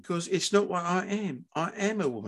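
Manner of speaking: sincere son, high and gentle; quietly insistent son. Acted. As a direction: state your truth softly but firmly. Son asserts identity quietly.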